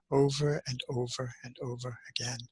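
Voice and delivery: a breathy whisper. Real, not acted. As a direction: drop to a whisper, as if the chant still echoes. Remembering the endless chant.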